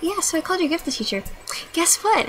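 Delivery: a lightly voiced, sing-song mock of the VP; mock vice principal. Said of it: "She's like".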